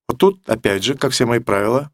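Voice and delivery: normal talking voice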